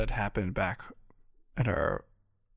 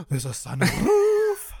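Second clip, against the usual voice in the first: slow, much higher, louder gasping delight; mock thrilled; 11 semitones higher, 6 dB louder, slower than usual. Friend hears about the sunroof, gasps in thrilled delight. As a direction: gush over it like a dream come true. Excited gasp at sunroof news.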